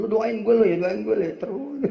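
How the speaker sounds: loud high retort; mock-angry wife talking back; wife snapping back. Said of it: "wife retorts at praying husband". (istri membalas dengan keras dan tinggi)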